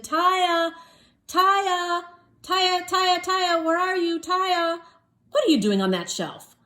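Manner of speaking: high, loud calling down the aisles; father searching the store for his daughter. Acted. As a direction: call for a lost daughter. Father calls for lost child.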